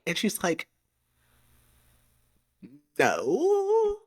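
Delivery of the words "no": very high, stretched "no"; drawn-out mock denial. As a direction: squeak out an exaggerated no. Acts out heroine's reply in dialogue.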